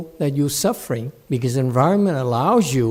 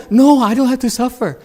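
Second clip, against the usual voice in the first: high mocking protest; a touch of mock whining; mock whine; 9 semitones higher, 8 dB louder than usual. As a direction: parody the student's denial. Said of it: "But you say".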